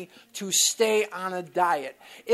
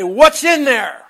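loud, slow, higher question; retold loud demand; 5 semitones higher, 12 dB louder than usual. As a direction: poke the question at his chest. Sponsor poking his chest.

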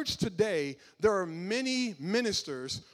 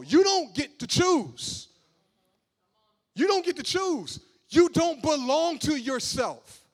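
high, loud preacher's cry building to a shout; preaching at full force; 8 semitones higher, 7 dB louder than usual. Real, not acted. Preacher to the congregation, full-force loud preaching. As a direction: drive it home from the pulpit, all means all.

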